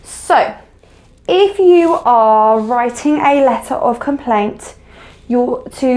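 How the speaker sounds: normal talking voice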